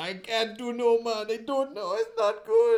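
high, distraught mock wail; a hammed-up grieving wail. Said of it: "wailing and gnashing his teeth".

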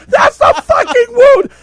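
very high, loud yelp of pain; pained shriek. Retold or imitated. Teller yelps at his partner squeezing the wound.

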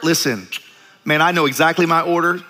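ordinary delivery

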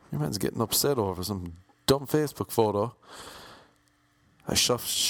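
ordinary delivery

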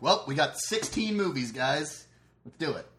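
slow, loud, pumped-up announcement; rally the group for a marathon; hyped up. Retold or imitated. Hyping up a movie marathon.

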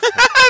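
sudden squeaky laugh; high burst of laughter. Real, not acted. Laughing at own line.

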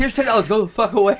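high-pitched joking get-lost; high mock dismissal. Friend joining the joke, dismissing the waiter in a high mock voice. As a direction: wave off the waiter in a high, playful voice.

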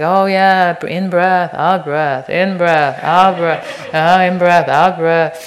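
a hammed-up bored meditator grinding through the breaths; mocking the grim, dutiful practitioner. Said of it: "grim duty that you've signed up".

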